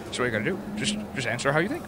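low, fast, stammering voice; deep rushed voice. Acted. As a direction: rush the words in a low voice. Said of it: "another Frank Allen".

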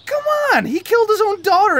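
high, exasperated outburst. Acted. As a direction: throw up your hands.

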